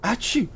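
high-pitched spoken "achoo"; acted sneeze. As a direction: fake a sudden, squeaky sneeze. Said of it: "loudly sneezes".

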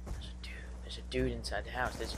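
hushed whisper. Real, not acted. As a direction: whisper the warning so nobody hears. Spotting enemy, hushed warning.

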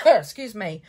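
ordinary delivery

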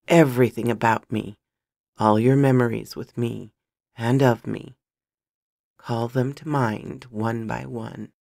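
low, loud, insistent character voice; intense, commanding Alex. A narrator's reading. Alex commands the dazed listener.

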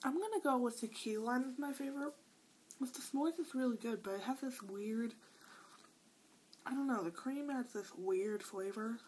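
eating between words; talking while chewing. Real, not acted. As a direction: mouth partly full of cookie. Seen 3: Holds cookie, takes bites between phrases, chews throughout.

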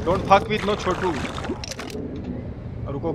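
ordinary delivery

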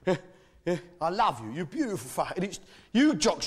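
ordinary talk, nothing marked

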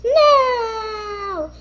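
high, loud panicked wail; high loud upset wailing. Acted. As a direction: cry out in dismay over the trip. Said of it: "Hello Kitty now was going to say".

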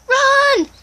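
high, loud yell; urgent shout. Real, not acted. Boy urging friend to flee, high urgent shout. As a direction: yell it out in alarm. Sudden danger in the game.

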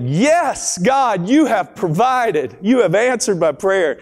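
high, excited cry of thanks; joyful shout. Retold or imitated. Single mom shouting thanks for groceries, high and excited. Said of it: "she shouted".

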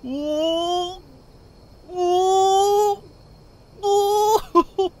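rising high whoas of delight; thrilled whoa. Real, not acted. Thrilled during a wild ride.

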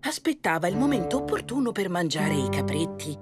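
usual speaking voice

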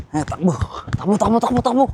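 breathless urging to run; high, loud run-run chant; while running. Real, not acted. Urges himself to run.

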